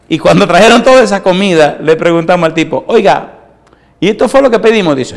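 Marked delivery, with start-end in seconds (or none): 2.9-5.0 raised, incredulous pitch; amazed question. Retold or imitated. Pastor asks the waiter about the huge order, incredulous. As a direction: ask like you can't believe the portion.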